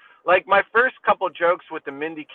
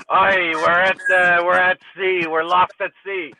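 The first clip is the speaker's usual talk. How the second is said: put-on pirate accent; 4 semitones higher, 4 dB louder than usual. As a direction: call it out like an old sea pirate. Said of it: "without a pirate accent".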